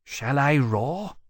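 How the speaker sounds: slow, hesitant question from a gentle dragon; uncertain. A narrator's reading. Temeraire unsure, asking Lawrence whether to roar, hesitant. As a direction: ask as if unsure it is allowed. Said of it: "said uncertainly".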